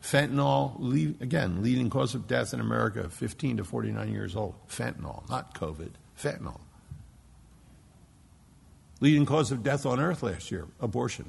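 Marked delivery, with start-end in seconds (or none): 4.7-6.6 grave hushed emphasis. Real, not acted. Grave repetition of the drug.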